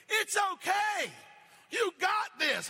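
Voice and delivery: high, loud, slow call from the boat; encouraging shout. Pretend. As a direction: yell encouragement across the water. Cheering a drowning swimmer.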